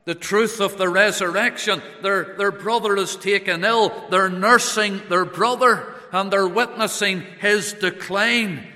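loud, high, ringing pulpit voice; full-force preaching. Acted. Preacher stirring the mourners at full force. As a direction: preach it out to the congregation.

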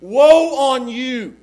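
loud, high, very slow; preacher showing the scare; dire warning. Shown as a demonstration. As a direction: drag out the curse. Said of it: "These words were meant to scare them".

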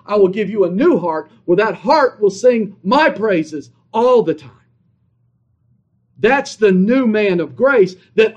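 emphatic, punchy sermon delivery; forceful preaching. Real, not acted. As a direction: drive each declaration home to the congregation.

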